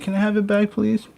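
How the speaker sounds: put-on high, pleading child voice; mock a kid begging to get his toy back; playfully imitating a child who wants his stuff back after barely behaving; whiny kid begging. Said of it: "be like".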